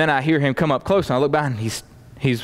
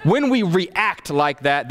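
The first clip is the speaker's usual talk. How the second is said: ordinary talk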